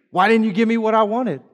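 high, whiny complaint; sulky plea to God, pitched high; mock tantrum. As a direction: whine it like a child denied a treat. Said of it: "You throw a tantrum".

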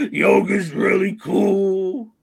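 high, slow, loud put-on rocker drawl. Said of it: "What other wholesome [name] things".

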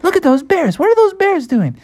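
very high, squeaky onlookers; high-pitched crowd voice; gawking tourists. Acted. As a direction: squeal it like astonished sightseers. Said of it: "everybody was like".

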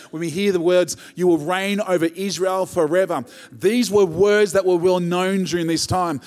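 rising fervour, driving the point home; building preaching. Real, not acted. Sermon builds to climax.

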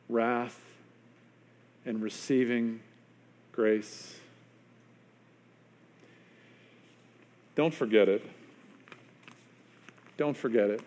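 soft, low words between long pauses; solemn, hushed close. Real, not acted. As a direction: land each phrase gently and gravely.

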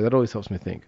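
talking over a sip of beer; glass at the lips, quiet; while sipping a drink. Real, not acted. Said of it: "Hold on, I gotta take a sip of beer".

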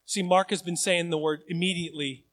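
ordinary talk, nothing marked

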